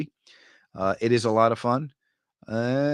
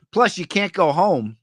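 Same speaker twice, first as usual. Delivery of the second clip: ordinary talk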